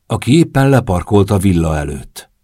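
ordinary delivery

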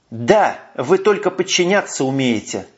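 ordinary delivery, nothing marked

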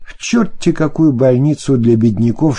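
ordinary delivery, nothing marked

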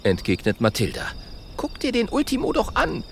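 put-on high girlish voice. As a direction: warn him sensibly. Mathilda voiced.

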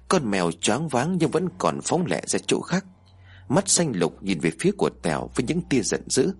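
usual speaking voice

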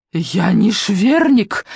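slow, drawn-out indignant shriek. A narrator's reading. Белла shrieking at Коваль over her surname. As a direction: shriek it in outrage. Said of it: "взвизгнула Белла".

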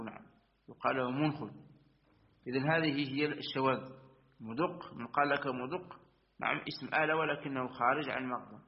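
usual speaking voice

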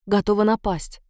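usual speaking voice

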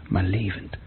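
a private thought, barely voiced; whispered. Young man lost in thought about her eyes, whispering. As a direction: breathe it out in a whisper.

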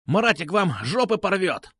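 high-pitched squawked threat; yelled threat. A narrator's reading. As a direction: threaten in a yelping voice. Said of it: "завопил он". (вопить с угрозой)

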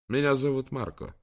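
ordinary delivery, nothing marked

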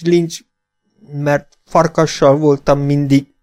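high, slow pleading in broken words; frightened begging. Acted. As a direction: beg him not to leave you. Half-breed begging not to be left. (magas, lassú, rémült könyörgés)

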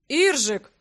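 loud stunned exclamation of a name; dumbfounded. A narrator's reading. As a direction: call out in disbelief. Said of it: "оторопел Грег".

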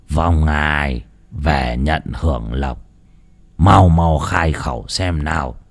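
low, slow commanding priest; stern old priest. A narrator's reading. Priest orders the possessing spirit to speak, stern and slow. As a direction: order the ghost to speak, slowly.

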